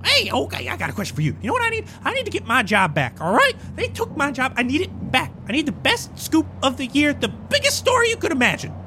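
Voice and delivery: high, pushy reporter; frantic reporter voice. Acted. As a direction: pester them like a desperate journalist. Desperate reporter NPC.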